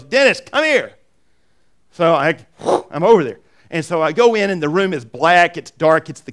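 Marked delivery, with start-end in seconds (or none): 0.0-0.9 friend calling out. Retold or imitated. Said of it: "door and he yells".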